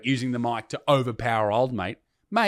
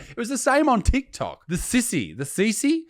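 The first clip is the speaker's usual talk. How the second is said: normal talking voice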